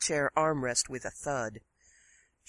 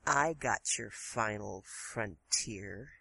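soft and smug; 6 semitones lower, 6 dB quieter, slower than usual. Acted. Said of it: "Smiling softly, she said".

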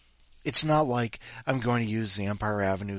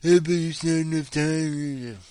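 mocking quote; 6 semitones higher, 5 dB louder than usual. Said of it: "that Empire Avenue noise of".

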